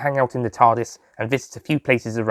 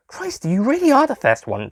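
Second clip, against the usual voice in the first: higher Susie voice; 12 semitones higher than usual. Acted. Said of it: "Susie said".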